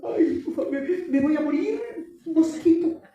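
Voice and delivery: high, laughing mock whine; whiny child. Pastor mocks a child wailing over a mosquito bite, high and laughing. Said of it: "Le pica un mosquito y dicen".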